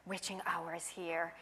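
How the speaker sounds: low soft muttered line; muttering. Acted. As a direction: mutter it under your breath. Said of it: "Mom mutter".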